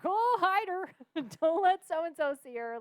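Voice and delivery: high, loud frantic hushing; mock panicked friends. She voices her friends hiding her from the boss, high and loud. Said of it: "we were like".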